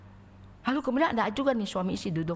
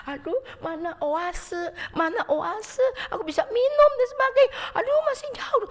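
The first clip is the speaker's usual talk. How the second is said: much higher, louder crying plea; desperate thirst; 14 semitones higher, 6 dB louder than usual. Acted. Thirsty wanderer in the desert, a high desperate wail. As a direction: wail for an oasis. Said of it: "orang yang jalan di padang pasir".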